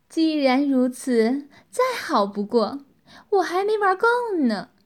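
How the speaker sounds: loud gleeful relish, rising high at the end; delighted relish. A narrator's reading. Woman pleased that her captive still resists, gleeful relish. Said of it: "竟然露出欢愉之色".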